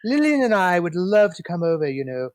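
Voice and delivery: mock a grand old Hollywood way of speaking; old Hollywood dialect. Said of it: "It was like an old Hollywood dialect".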